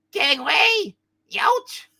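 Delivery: very high cartoon yelps; comic high-pitched alarm; pained fleeing yelp. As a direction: cry out high while scrambling away. Figure flees in pain.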